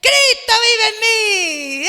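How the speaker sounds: loud, slow, high proclamation; bold declaration. Shown as a demonstration. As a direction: proclaim it with full force. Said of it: "Qué tiene que hacer usted".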